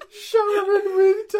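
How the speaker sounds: wheezing it out through real laughter; high and loud, cracking up; gasping the title between laughs; laughing. Real, not acted.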